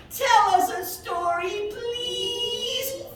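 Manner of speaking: high, slow, begging little voice; pleading baby elephant. A narrator's reading. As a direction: beg sweetly like a tiny elephant.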